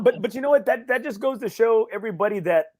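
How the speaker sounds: ordinary talk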